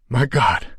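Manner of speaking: the words catch in his throat; shocked choke; choked. A narrator's reading. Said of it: "choked Steve".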